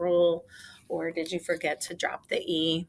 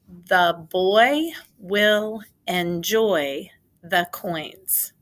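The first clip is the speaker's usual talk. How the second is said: put-on The boy will enjoy the coins said slow and louder, word by word; slow dictation; 7 dB louder, much slower than usual. Shown as a demonstration. Teacher dictating a sentence slowly to the class. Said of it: "Say it with me".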